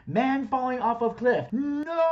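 hammed-up cliff-fall yell; mock falling scream. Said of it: "my personal favorite".

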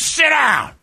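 high-pitched imitated yell; barked order. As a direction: snap it out, imitating a scolding. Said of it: "Like, exactly like that".

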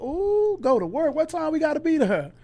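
high, bright-eyed eagerness to start work; eager excitement. Retold or imitated. Eager new worker quoted.

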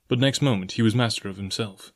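plain reading voice

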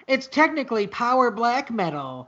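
mocking know-it-all voice; nasal nitpicking music snob; snobby metal fan. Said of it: "assholes like you being like".

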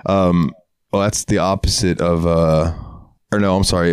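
usual speaking voice

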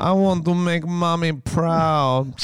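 imitating the sad tiger line; mimicking the clip. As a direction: copy the tiger's line from the film. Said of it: "clip each of us saying that".

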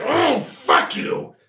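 high, slow, strained creature curse; wounded monster cursing. Acted. Monster shot in the throat curses back, strained and slow. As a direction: draw the curse out in mock agony. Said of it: "It's like".

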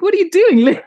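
high, loud thrilled cry; excited outburst. Retold or imitated. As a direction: burst out, loving every second. Enid thrilled by the fight.

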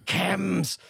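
hammed-up addict shout; mock junkie cry. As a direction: blurt it like a craving addict. Mock addict demanding chems.

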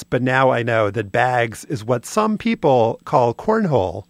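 usual speaking voice

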